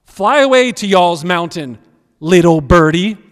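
very loud, deliberate word-for-word read; wooden literal reading. Shown as a demonstration. Preacher repeats the literal translation for listeners, woodenly. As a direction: sound out the translation stiffly and loudly. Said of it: "That would be a very wooden translation".

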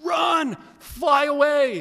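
high, drawn-out calling cry; urgent urging. Acted. Voices urge David to flee, calling out high. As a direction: call it out high, stretching the words. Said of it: "that's what's being encouraged here to David".

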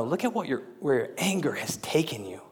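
hushed, breathy whisper; a soft confronting whisper. Acted. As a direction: lean in and whisper the warning. God confronts Jonah, hushed.

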